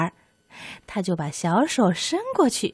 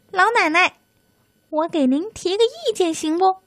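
tiny high squeaky mouse voice; thin soft voice; 9 semitones higher than usual. Acted. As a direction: speak small and thin like a little mouse. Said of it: "细声细气地说".

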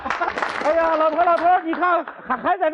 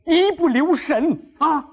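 normal talking voice

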